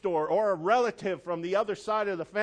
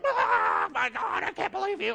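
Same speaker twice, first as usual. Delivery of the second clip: very high, shrieking offended voice; mock outrage; 3 dB louder than usual. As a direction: overplay someone losing their mind. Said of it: "You're like".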